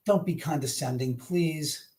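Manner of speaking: ordinary talk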